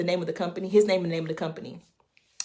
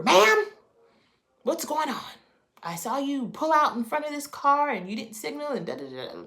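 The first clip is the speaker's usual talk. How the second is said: police officer; 8 semitones higher than usual. Retold or imitated. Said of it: "So he pulls me over".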